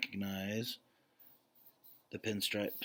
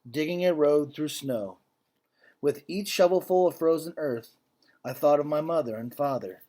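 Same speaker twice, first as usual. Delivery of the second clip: solemn, louder read-aloud voice; story narration; 5 semitones higher, 14 dB louder than usual. A narrator's reading. Teacher reading a Holocaust story to the class, grave narration. Reads picture book to class.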